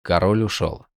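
usual speaking voice

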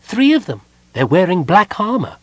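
high, loud cry from a small kender; shrieky little lookout spotting the enemy; excited cry. A narrator's reading. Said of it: "Tasselhoff cried".

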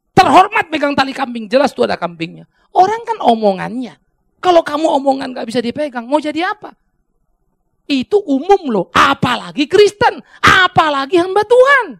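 loud, high, emphatic pulpit rebuke; fired-up preacher admonishing the congregation; full-force preaching. Real, not acted. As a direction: drive each phrase home, ending in a high shout.

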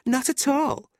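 very high, breezy retort; airy denial. A narrator's reading. Tilda parrying Fanny's insult, airy. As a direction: deny it with pitch lifted.

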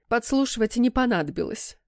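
plain reading voice

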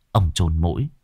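ordinary delivery

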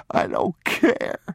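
high, slow, sobbed defiance; sobbing child. A narrator's reading. Boy defying his father's warning, high sobbing. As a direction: wail it slowly as a crying boy.

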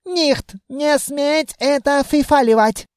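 put-on high-pitched officer with a Germanic accent; western elf accent. A narrator's reading. Officer forbids dumping the man, western accent. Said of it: "Акцент. Самый что ни на есть западный".

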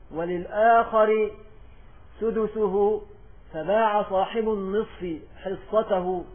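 loud, slow reading from a book; measured, projected reading with drawn-out phrase endings; formal text recitation. Real, not acted. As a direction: read out a classical text for students, slowly and loudly. Reads the book text aloud to class.

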